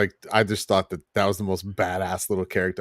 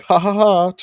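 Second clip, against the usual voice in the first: mock laugh; 10 semitones higher, 5 dB louder than usual. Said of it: "then she's like".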